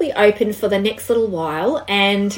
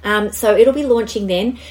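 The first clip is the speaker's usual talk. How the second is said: ordinary talk, nothing marked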